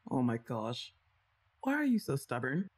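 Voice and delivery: normal talking voice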